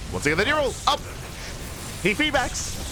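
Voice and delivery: excited call. Real, not acted. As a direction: voice shooting up high on the spells. Caster hyped at repeated combo.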